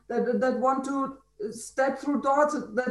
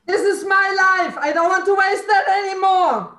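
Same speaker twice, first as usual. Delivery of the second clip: high, insistent yell; real angry shout; 6 semitones higher, 4 dB louder than usual. Real, not acted. Participant claiming her life back, furious shout. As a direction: shout it as a declaration.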